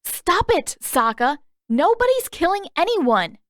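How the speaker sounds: high, loud alarmed shout. Acted. Katara yelling at Sokka over killing Zuko, high and loud. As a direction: cry out to stop him.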